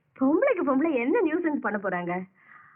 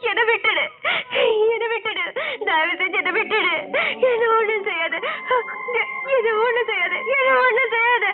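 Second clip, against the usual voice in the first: panicked pleading, rising louder and higher; terrified begging; 5 semitones higher, 3 dB louder than usual. Acted. Woman begs the ghost to spare her, terrified. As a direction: beg for your life, sobbing.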